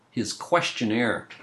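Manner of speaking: ordinary delivery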